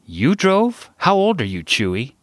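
high-pitched, confused questioning. A narrator's reading. Sarah asking young Chewy about driving, confused.

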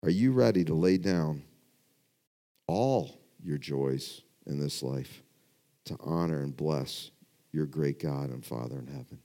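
the second, gentler asking of the question; asked again softly. Real, not acted. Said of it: "I'll, I'll ask it again".

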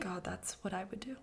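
breathy whispered aside; whispering. Real, not acted. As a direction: whisper it under your breath. Whispered aside while reading.

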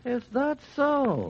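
high, slow, drawn-out umpire's skeptical retort. Pretend. Cohost retorts to Leo, high slow umpire voice. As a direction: needle him like a stubborn umpire. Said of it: "you sounded just like an umpire I know".

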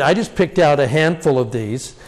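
normal talking voice

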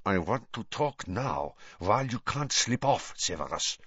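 talking through barely open lips; like a poor ventriloquist; lips barely moving. Acted. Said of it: "barely opening his lips".

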